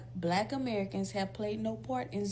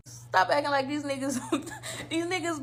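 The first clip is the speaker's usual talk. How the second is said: laughter breaking into a louder rant; amused, incredulous rant; laughing; 4 semitones higher, 4 dB louder than usual. Real, not acted. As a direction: let a laugh spill into the words.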